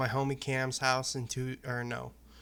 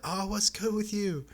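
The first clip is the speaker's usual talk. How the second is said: high-pitched, enthusiastic greeting; imitated excited hello; 8 semitones higher, 4 dB louder than usual. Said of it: "And he's like".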